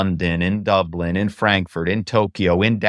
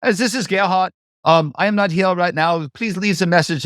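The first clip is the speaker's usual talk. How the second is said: put-on higher-pitched German-accented voicemail greeting; Gerhard, German accent; 7 semitones higher than usual. Executive's outgoing voicemail for callers, imitated in a German accent. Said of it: "I could imitate their voice".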